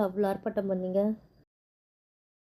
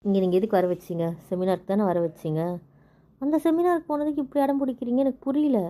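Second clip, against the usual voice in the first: louder and higher, frustrated yelling; angry shout; 4 semitones higher, 4 dB louder than usual. Acted. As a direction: shout at him, fed up. Wife shouts back in quarrel. (கோபமான கத்தல்)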